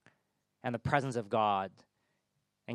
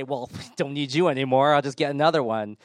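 loud, raised, offhand dismissal; dismissive; 4 semitones higher, 12 dB louder than usual. Acted. God who could strike down Elijah, loudly dismissive. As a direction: brush him off loudly.

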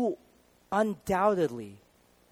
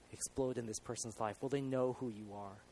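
soft prayer; 4 semitones lower, 9 dB quieter than usual. Acted. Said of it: "I start praying".

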